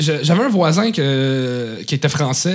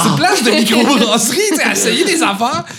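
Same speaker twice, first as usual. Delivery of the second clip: eager laughing pitch; 8 semitones higher than usual. Retold or imitated. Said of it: "Je suis comme".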